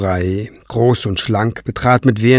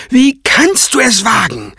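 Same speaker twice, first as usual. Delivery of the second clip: high, louder indignant shriek; 12 semitones higher, 3 dB louder than usual. Acted. Craven outraged at Kranz's insult, a high, loud shriek. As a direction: screech in outrage.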